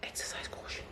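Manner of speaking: whispered warning. Shown as a demonstration. Vlogger whispers a warning to viewers. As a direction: breathe it as a hushed warning. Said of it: "You guys just got to exercise caution".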